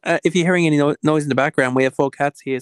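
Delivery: projected so the other person hears. Real, not acted. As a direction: repeat it loudly and clearly. Repeats louder after listener says sorry.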